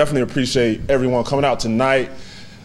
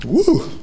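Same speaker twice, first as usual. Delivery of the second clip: high whoop to steady himself; emotional woo; 13 semitones higher than usual. Real, not acted. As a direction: let out a high woo to hold back tears. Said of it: "I'ma make it, I promise".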